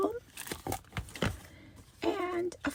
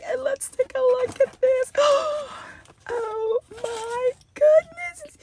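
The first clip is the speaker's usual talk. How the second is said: excited; 7 semitones higher, 8 dB louder than usual. Real, not acted. Opening the cute sunflower cup.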